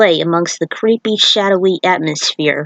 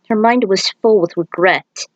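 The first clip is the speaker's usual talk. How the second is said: plain reading voice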